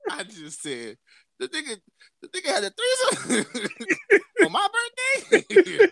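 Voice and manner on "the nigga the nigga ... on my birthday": very high squeal breaking into laughter; hot, incredulous. Retold or imitated. Man learns friend had a threesome on his birthday, outraged. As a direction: build to a loud, laughing mock outrage. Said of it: "I'm hot".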